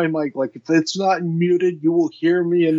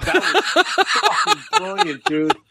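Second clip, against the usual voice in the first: laughing praise. Real, not acted.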